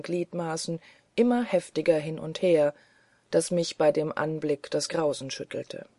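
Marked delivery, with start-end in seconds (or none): none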